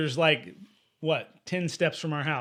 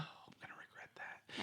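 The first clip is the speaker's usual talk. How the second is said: whisper; 25 dB quieter than usual. Real, not acted. Aside about painting.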